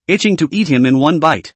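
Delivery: usual speaking voice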